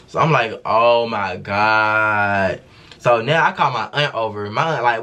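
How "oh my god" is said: very slow, stretched-out groan of the words; drawn-out dismay. Retold or imitated. Realizing she is caught by the guard, a long slow dismayed oh my God. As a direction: dread the whole thing, drag it out. Dread at being taken to the back.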